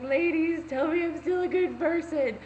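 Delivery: high, loud appeal to an audience; mock rallying plea. Posting a body picture to the ladies online, mock pleading. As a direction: call out to followers, hammed-up and needy.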